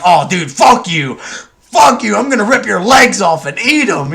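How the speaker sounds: retold heat-of-the-moment fury, loud and high; mock angry outburst. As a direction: ham up a threatening yell, half joking. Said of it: "you're just like".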